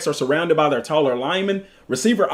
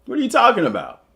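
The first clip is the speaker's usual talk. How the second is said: normal talking voice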